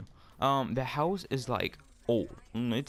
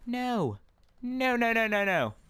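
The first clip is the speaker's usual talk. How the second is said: high-pitched string of refusals; emphatic refusal; 10 semitones higher, slower than usual. Real, not acted. Storyteller balking at the back entrance, emphatic refusal.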